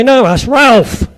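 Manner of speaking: loud, drawn-out Suffolk "Ralph" with the L sounded; emphatic Suffolk accent, slow and loud; Suffolk pronunciation. Shown as a demonstration. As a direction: correct them in broad Suffolk. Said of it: "in Suffolk we say".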